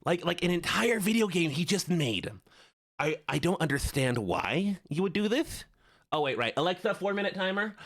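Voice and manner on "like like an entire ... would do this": lisping nerd; put-on nerdy lisp. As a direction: talk like an incredulous lisping nerd. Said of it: "All right, the nerd voice".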